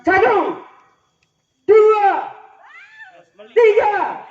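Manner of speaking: loud high counting call; shouted counting for a group game. Real, not acted.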